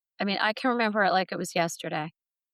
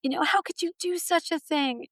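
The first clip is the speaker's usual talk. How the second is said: a hint of a stern scold, higher; scolding inner voice; 9 semitones higher than usual. Her head scolding her after the crash, higher scolding. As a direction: scold yourself like a disappointed parent. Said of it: "my head's scolding me".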